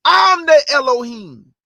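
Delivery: slow, loud proclamation as the Almighty; booming God voice. Acted. Preacher voicing God to the congregation, booming and slow. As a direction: announce yourself like God on high.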